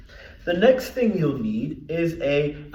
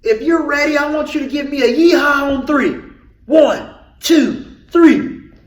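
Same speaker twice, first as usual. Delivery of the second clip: excited hype; 8 semitones higher, 9 dB louder than usual. Real, not acted. Hyping kids up for call.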